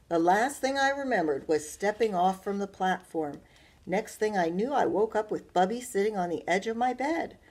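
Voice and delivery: put-on lively, loud read-aloud for children; bright storyteller voice; story narration. A narrator's reading. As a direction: read it to a class with animation. Teacher reads story aloud.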